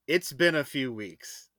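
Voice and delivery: slow, pointed understatement; loaded understatement. Real, not acted. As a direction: say it slow and knowing. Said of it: "I'll just say that".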